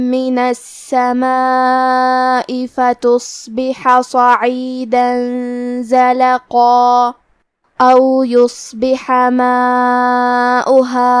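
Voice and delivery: slow, measured tajweed chant; Quran recitation. Acted. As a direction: recite the verse slowly and devoutly, every rule held. Recites the verse after the lesson.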